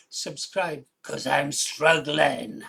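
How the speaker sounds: mock Australian begging for subs, slow; imitating an Australian accent. Vlogger quoting an Australian YouTuber's plea, in an Australian accent. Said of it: "What is it the Australian guy says?".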